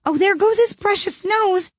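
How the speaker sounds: very high frightened squeal; terrified shriek. A narrator's reading. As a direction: squeal in panic. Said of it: "agony of terror".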